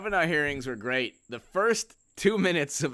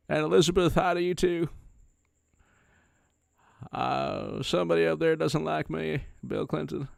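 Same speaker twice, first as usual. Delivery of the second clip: normal talking voice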